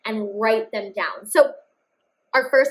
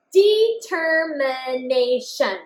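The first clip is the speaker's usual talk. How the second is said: Determination said slowly, every syllable clear; slow check read; much slower than usual. Shown as a demonstration. Teacher rereads the written word to check it. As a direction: read it back slowly and carefully. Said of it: "I wrote all the sounds that I heard".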